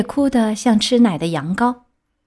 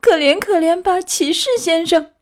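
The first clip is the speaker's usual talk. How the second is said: pleading; 9 semitones higher than usual. A narrator's reading. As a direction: beg for mercy in a high-pitched wail. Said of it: "开始哀求他说". (尖着嗓子哀求)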